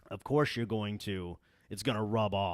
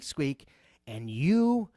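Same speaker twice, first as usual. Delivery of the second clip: ordinary talk, nothing marked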